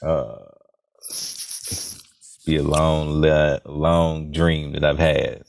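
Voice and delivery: low, slow crooner voice; deep, unhurried soul-singer voice. Pretend. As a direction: drop your pitch and draw it out seductively.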